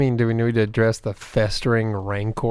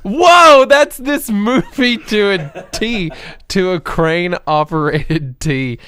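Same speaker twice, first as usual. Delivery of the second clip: loud excited; 5 semitones higher, 6 dB louder, slower than usual. Real, not acted. Loud mock amazement.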